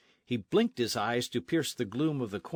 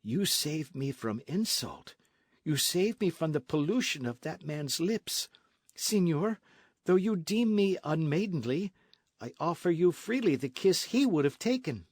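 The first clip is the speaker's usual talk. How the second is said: put-on young lady, smitten; 7 semitones higher than usual. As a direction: offer the kiss shyly but boldly. Lolita's voice.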